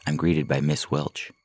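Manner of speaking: usual speaking voice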